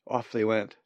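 usual speaking voice

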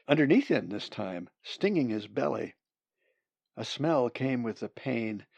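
usual speaking voice

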